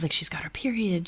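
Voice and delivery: soft unvoiced whisper. Real, not acted. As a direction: keep it secret, whisper. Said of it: "I have to whisper".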